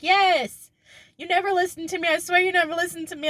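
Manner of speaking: high-pitched mock exasperation; mad sister. Imitating sister fed up at never being heard, mock anger. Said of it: "she just gets so mad at me".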